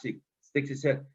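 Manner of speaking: usual speaking voice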